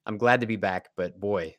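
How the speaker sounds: usual speaking voice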